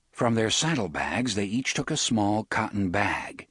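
ordinary delivery

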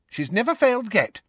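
put-on firm, high-pitched girl; high, certain reassurance. A narrator's reading. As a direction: insist with confidence. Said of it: "said Alicia Spinett firmly".

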